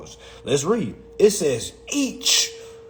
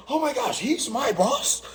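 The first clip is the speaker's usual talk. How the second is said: shocked employee; 9 semitones higher, 3 dB quieter than usual. Acted. Employee discovering a gangbanger is his boss, mock shock. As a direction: put on a stunned, high voice.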